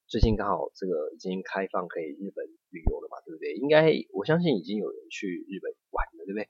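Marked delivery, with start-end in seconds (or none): none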